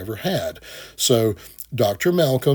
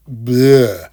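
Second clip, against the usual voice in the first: loud gagging bleh of disgust; a loud fake retch of disgust; 7 dB louder than usual. Real, not acted. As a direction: spit out a loud bleh like bad food. Disgusted at hot garbage.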